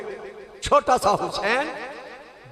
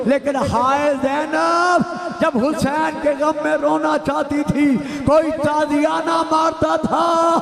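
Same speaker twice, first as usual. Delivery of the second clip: louder and higher, building into a wailing lament; preaching at full force; 5 semitones higher, 4 dB louder than usual. Acted. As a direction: climb from fervent address to a sobbing cry of grief. Majlis lament rising to climax.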